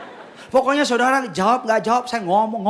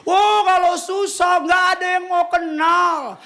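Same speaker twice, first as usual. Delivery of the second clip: heartfelt declamation; 9 semitones higher, slower than usual. Acted. Preacher shouting the lesson with emotion. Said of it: "penghayatan ngomongnya".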